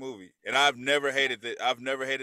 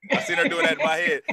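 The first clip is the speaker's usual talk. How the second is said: laughing; 12 semitones higher, 6 dB louder, faster than usual. Real, not acted. Laughing at own remark.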